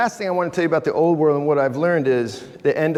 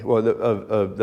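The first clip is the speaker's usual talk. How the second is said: ordinary talk, nothing marked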